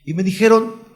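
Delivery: ordinary talk, nothing marked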